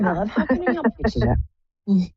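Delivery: ordinary talk, nothing marked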